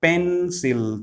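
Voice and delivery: "Pencil" in two beats, pen-sil; teacher splitting "Pencil" into two syllables. Shown as a demonstration. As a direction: draw out both halves of "Pencil". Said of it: "Two syllabic words".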